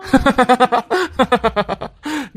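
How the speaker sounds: a long open laugh that rises at the end; hearty laugh. Acted. Young man laughs at a phone comedy reel, hearty laugh.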